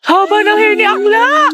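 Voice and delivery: high-pitched hype for a friend; giddy, high teasing cheer; teasing squeal. Acted. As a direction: shriek it in playful envy. Friends tease over Valentine surprise.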